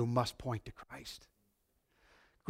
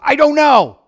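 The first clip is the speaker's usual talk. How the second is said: emphatic loud retold reply, higher; blind man's answer; 10 semitones higher, 11 dB louder than usual. Blind man voiced in retelling.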